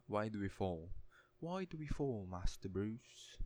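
English accent, Alfred to Master Bruce; English butler accent. Shown as a demonstration. Quoting Alfred to Bruce Wayne, English accent. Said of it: "That's my best attempt at an English accent".

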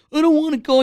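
mock a kid refusing to budge; mock tantrum. Imitating his son outside the church, mock tantrum. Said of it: "He's making a big old tantrum".

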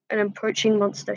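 ordinary delivery, nothing marked

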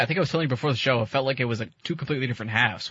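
usual speaking voice

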